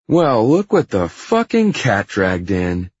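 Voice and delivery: slow, high, loud sneer. A narrator's reading. Hyde greeting the boss who stormed in, sneering. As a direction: draw it out with mocking contempt. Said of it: "Hyde says and sneers".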